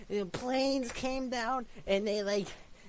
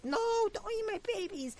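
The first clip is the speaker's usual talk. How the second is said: mama voice; 12 semitones higher, faster than usual. Acted. Mama pleads in silly story.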